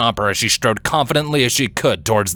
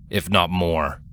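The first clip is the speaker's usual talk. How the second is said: plain reading voice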